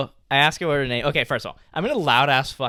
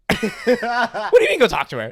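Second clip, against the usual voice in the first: high, louder panicked question through a laugh; nervous laugh; 8 semitones higher, 5 dB louder than usual. Retold or imitated. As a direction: thrown into it, flustered. Nervous retold reaction.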